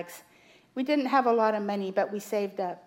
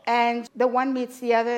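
normal talking voice

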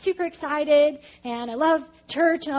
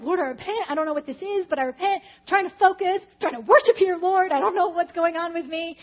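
raised, pressured plea to God; retold frantic prayer; 4 semitones higher, 5 dB louder than usual. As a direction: reenact struggling to pray through it. Acts out her struggling prayer.

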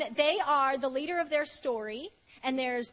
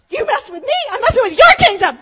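loud, high shouted challenge; fierce, playful war shout; defiant battle cry; 10 semitones higher, 17 dB louder, faster than usual. Retold or imitated. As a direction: yell it like a fired-up warrior throwing down. Mock battle challenge to the enemy.

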